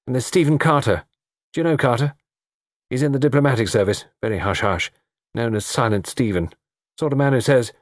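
breezy, gossipy introductions; chatty young man. Acted. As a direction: reel off the guests lightly.

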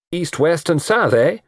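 high, jaunty teasing; cheeky, lightly amused; irrepressible jauntiness. A narrator's reading. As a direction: tick them off playfully, ending on a query. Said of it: "said the irrepressible Harry".

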